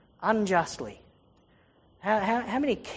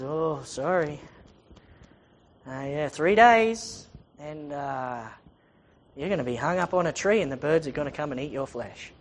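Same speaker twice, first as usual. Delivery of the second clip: reluctant bad news; 5 semitones lower than usual. Joseph reluctantly gives bad news.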